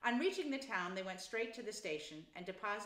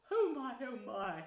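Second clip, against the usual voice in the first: enraptured sighing exclamation; 4 semitones higher, 5 dB louder than usual. Acted. Toad still in raptures.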